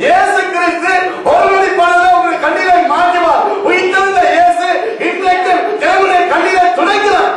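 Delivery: high, full-force sermon delivery; ringing preacher's cry; fervent preaching. Acted. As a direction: proclaim it urgently to the congregation.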